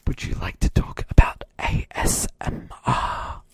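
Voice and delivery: mock ASMR whisper. Host asks Brad about ASMR, whispering.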